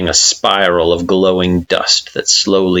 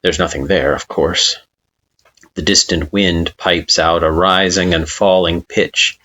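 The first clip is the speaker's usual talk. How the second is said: ordinary delivery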